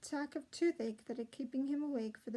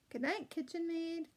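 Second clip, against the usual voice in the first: calling out; 5 semitones higher than usual. A narrator's reading. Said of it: "called Peregrine".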